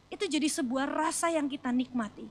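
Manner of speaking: ordinary talk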